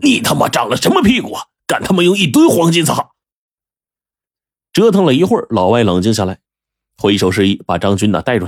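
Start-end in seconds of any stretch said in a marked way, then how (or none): 0.0-3.1 furious. Acted. Laowai kicks Zhang Jun and yells at him in a rage. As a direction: explode at him. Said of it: "怒气冲冲地".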